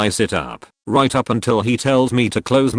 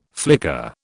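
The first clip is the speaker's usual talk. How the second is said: low flicker; 6 semitones lower than usual. Acted. Narrating the trip loop, a low dropped flicker. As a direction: say it low like a blink of lost time. Repeated refrain.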